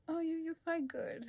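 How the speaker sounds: sheepish, hesitant, quiet; shy and awkward. Retold or imitated. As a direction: imitate a bashful man fumbling a compliment, slowly. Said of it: "so shy and awkward about it".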